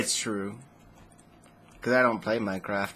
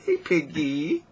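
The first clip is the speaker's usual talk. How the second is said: 1 comforting a pig; 4 semitones higher, slower than usual. Pretend.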